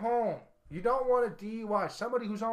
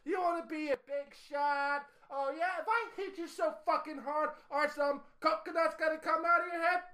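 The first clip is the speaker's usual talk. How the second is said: mock tough-guy taunt; 9 semitones higher than usual. Acting out taunting the wrestler.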